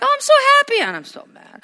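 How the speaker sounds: high, fast mock cry of joy; mock giddy exclaim. Pastor mocking fleeting happiness, high squealing voice. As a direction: squeal it like an overexcited fool.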